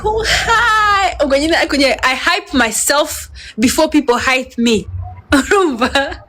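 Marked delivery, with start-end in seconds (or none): 0.1-1.1 high squealed hi with a laugh; coy, delighted hi to the camera; giddy hi. Retold or imitated. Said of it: "and I'm like".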